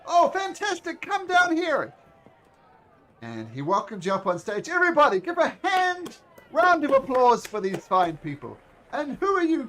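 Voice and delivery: booming high showman's welcome, then slowing down; grand ringmaster. Acted. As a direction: announce it like a circus ringmaster to the crowd. Ringmaster calls volunteers up.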